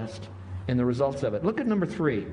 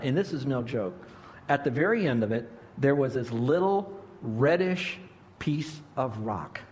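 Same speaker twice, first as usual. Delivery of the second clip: ordinary talk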